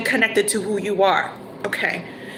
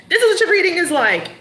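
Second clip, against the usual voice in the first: emphatic restatement; 7 semitones higher, faster than usual. Shown as a demonstration. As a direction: punch it home with energy.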